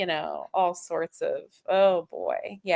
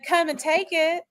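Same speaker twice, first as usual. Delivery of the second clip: Southern accent; 7 semitones higher than usual. Shown as a demonstration. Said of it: "what I sound like when I'm not neutralizing my accent".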